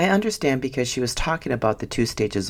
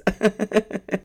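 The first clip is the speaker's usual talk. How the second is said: high, light run of chuckles; pleased, higher-pitched giggle; amused laugh; 9 semitones higher than usual. Real, not acted. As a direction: laugh at your own story. Laughs at her own story.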